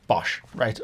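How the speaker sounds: ordinary talk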